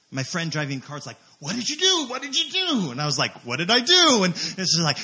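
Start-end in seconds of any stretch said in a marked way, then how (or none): 1.4-2.9 high-pitched frantic questioning; mock panicked demand; imitated alarmed friend. Alarmed friend in car, acted. 3.4-4.2 loud, high-pitched acted protest; indignant mock innocence; defensive comeback. Acted quarrel in retold story.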